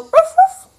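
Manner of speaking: high, loud playful woof woof; dog bark. Shown as a demonstration. Parent showing toddler a dog's bark, high and loud. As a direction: bark it like a puppy for the baby. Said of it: "Can you go".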